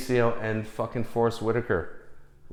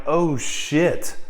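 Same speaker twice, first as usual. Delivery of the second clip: higher, awed oh shit; the penny drops, lightly played; starstruck surprise; 5 semitones higher than usual. Realizing Muhammad Ali is right beside him, amazed. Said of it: "And I'm like".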